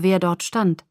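plain reading voice

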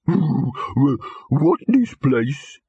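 a handsome dog with nothing upstairs; dim slow dog. A narrator's reading. Dim hunting dog speaks.

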